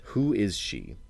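ordinary delivery

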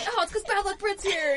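lightly voiced teasing friend. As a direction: play the friends ribbing her about her luck. Imitating people teasing her.

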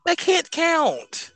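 high, slow stubborn protest; insisting. Real, not acted. Repeating to his friend that they can't count, high and slow.

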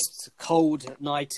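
normal talking voice

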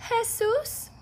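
put-on high, light child's reply; little boy voice. Little boy answering the narrator, high and light. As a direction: chirp it like a young kid. Boy says his name.

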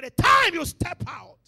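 sudden loud, high burst; preaching shout. Real, not acted. Preacher urging the congregation on, shouting. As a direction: throw it out to the whole congregation at full voice. Preacher rising to full force.

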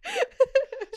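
very high, breathy fit of giggles. Real, not acted. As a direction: let a high giggle burst out. Laughing at own joke.

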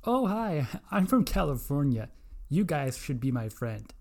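bright, loud mock Californian greeting; Californian impression. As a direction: gush like a bubbly Californian. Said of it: "here's my impersonation of a, uh, of a Californian".

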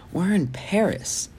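high, slow, amazed; breathless wonder at the sight; astonished. A narrator's reading. Sophie's astonishment at Eiffel Tower.

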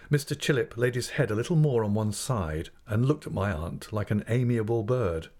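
plain reading voice

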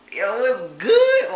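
slow, loud, drawn-out greeting to a woman; smooth pickup line. Retold or imitated. Greeting a woman at college, slow loud smooth line. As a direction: lay on the charm, slowly. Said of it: "and I'm like".

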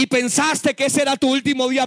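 ordinary talk

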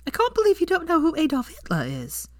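high, disbelieving tone; can't believe he doesn't know; incredulous Hermione. A narrator's reading. Hermione stunned at Malfoy's ignorance, high.

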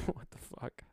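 breathy, disbelieving laugh-whisper under the breath. Real, not acted. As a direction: mutter it through a laugh, slowed down.

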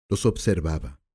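plain reading voice

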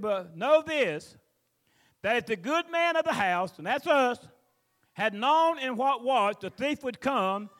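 loud, slow preacher reading; preaching scripture. Acted. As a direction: proclaim it slowly to the congregation. Preacher reads scripture at full force.

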